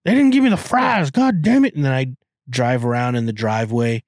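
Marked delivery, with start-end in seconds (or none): 0.0-1.7 much higher, louder hammed-up Southern accent; a riled-up Texan complaining about his fries; mock Southern complaint. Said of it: "I'd be saying things like that".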